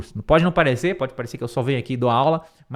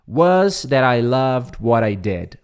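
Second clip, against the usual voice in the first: slow model line; 2 semitones lower, slower than usual. Shown as a demonstration. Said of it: "model sentence".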